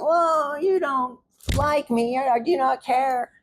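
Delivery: high, drawn-out wail mocking a partner; whiny mock impression. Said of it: "Is that how I sound?".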